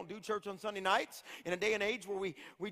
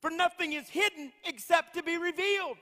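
high, raised preacher's cry; fervent preaching; 7 semitones higher than usual. Real, not acted. Preacher driving home the hidden verse to church, fervent. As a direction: proclaim it high and emphatic to the whole room.

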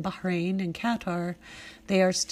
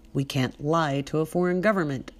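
plain reading voice

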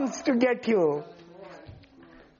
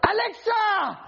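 calling out a name; 8 semitones higher than usual. Retold or imitated. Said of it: "He's gonna call".

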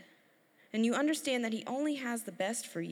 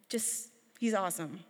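ordinary talk

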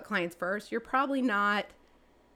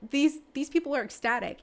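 high-pitched, louder, animated; excited emphasis; 8 semitones higher, 4 dB louder than usual. Real, not acted. Agent telling interviewer how thrilled New York buyers are, excited. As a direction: gush with amazement, pitch riding high.

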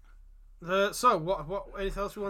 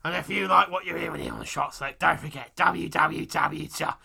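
loud, deliberate mock Cockney; Bradley Walsh; 8 dB louder than usual. Host plugging the show mid-chase, loud hammy Cockney. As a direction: belt it out as a sweary Cockney host. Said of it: "the only Cockney voice I can do".

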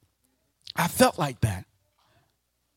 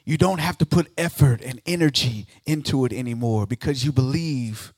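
ordinary talk, nothing marked

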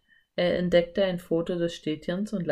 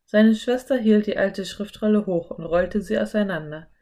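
ordinary delivery, nothing marked

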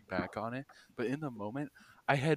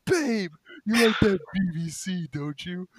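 leering stoned guy; 8 semitones higher, 9 dB louder than usual. Retold or imitated. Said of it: "he goes".

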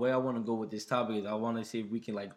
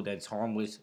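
ordinary talk, nothing marked

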